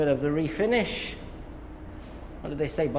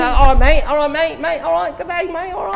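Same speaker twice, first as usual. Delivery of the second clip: high, hammed-up Australian mate-speak; Australian accent; 11 semitones higher, 8 dB louder than usual. Parent mimicking kids' sayings, mock Australian accent. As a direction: imitate kids putting on Australian accents. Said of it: "they're really doing Australian accents".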